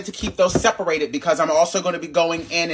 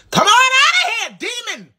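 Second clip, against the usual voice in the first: mock exorcist; 4 dB louder than usual. Cook shouts at stuck oyster sauce like a preacher casting out a demon. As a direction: rebuke it like a preacher throwing out a devil.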